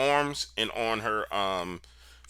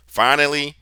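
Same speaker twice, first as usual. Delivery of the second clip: usual speaking voice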